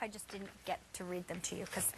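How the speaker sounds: whispered aside. Real, not acted. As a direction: whisper it to the viewers. Aside to co-host.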